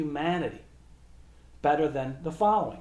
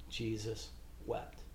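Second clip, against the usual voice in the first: much lower and softer, reverent close; hushed, solemn; 7 semitones lower, 11 dB quieter than usual. Real, not acted. Preacher reading the shortest Gospel verse to the congregation, hushed and solemn. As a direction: let the voice drop low and quiet.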